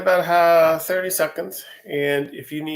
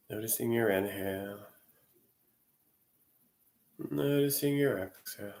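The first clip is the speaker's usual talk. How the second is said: guided meditation; 2 semitones lower, 4 dB quieter than usual. Real, not acted. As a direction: ease them gently into the body scan, unhurried. Leads a Yoga Nidra session.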